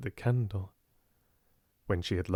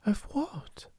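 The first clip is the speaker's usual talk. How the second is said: hushed curious whisper; whispered question; 11 semitones higher, 5 dB quieter than usual. A narrator's reading. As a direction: whisper it, soft and high. Sarah asking quietly in dark.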